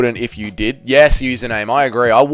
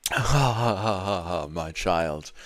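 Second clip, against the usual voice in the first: low, hushed guru laugh; a hint of a mystic preacher, low and soft; Light Lord voice; 6 semitones lower, 12 dB quieter, slower than usual. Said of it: "Can you do an impression of Light Lord Ben Rothwell?".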